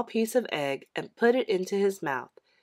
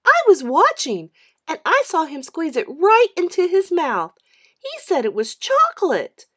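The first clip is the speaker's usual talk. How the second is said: high, loud, indignant report; a kid telling on her brother; insistent little girl, high and loud; tattling little sister; 9 semitones higher, 9 dB louder than usual. A narrator's reading. Mary voiced as a child.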